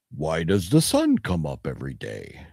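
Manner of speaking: a hint of Connery, low and musing; lightly voiced Connery. Fan quotes the Highlander mentor in a light, low mock-wise tone. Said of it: "I think of him going".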